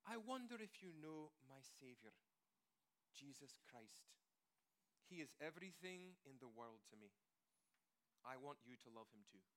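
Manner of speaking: put-on gentle elderly lady. Elderly lady quoted in story.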